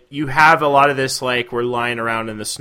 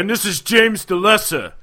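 put-on Brooklyn accent; 7 semitones higher, 4 dB louder than usual. Mocking Brooklyn-accent apostles.

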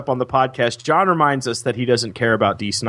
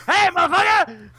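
much higher hammed-up shout; imitating his friend yelling at a fan; mock yell. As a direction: greet him with a big mock yell. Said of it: "run up to him and go".

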